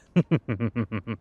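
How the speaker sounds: rapid, rippling chuckle; short, amused vampire laugh. Acted. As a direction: chuckle to yourself at your own remark. Amused after vampire remark.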